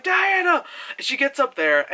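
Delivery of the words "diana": much higher, louder call; mock call. Reviewer quoting the hero calling out to Diana, much higher and louder. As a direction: call her name across the battlefield. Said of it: "he's like".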